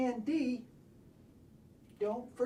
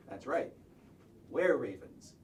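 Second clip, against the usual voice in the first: usual speaking voice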